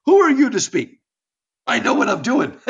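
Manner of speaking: loud, high-pitched rebuke; God from the whirlwind. Acted. God rebuking Job from the whirlwind, loud and high. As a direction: thunder it out as an indignant God. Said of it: "God speaks to him out of the whirlwind".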